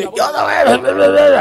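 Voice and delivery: lightly mock the stoner buddy's voice; playful impression of a friend's stoner voice. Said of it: "pero también tiene la voz así".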